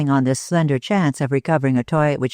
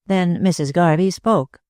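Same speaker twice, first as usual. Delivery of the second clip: ordinary delivery, nothing marked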